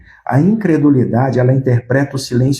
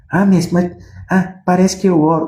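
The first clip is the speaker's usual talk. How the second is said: higher, whiny mock excuse; preacher mocking a believer who won't pray, pitched up; 6 semitones higher than usual. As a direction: mimic a lazy believer's excuse.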